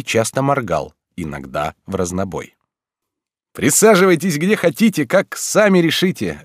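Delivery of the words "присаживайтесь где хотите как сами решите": high, cheerful eccentric teacher; joyful. A narrator's reading. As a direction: invite them in happily. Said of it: "радостно продолжал он". (радостно)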